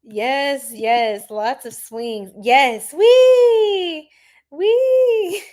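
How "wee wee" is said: high, loud playful squeal; a joyful mock squeal on the ride; gleeful wee. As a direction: squeal like you're on the swings. Mimics a ride on swings.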